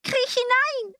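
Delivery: very high witch's order; commanding witch. A narrator's reading. Witch sending Gretel into the oven, commanding. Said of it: "sagte die Hexe".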